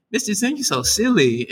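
a hint of a kid teasing his teacher.

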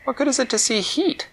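put-on puzzled young girl asking; high puzzled child. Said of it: "Winella said".

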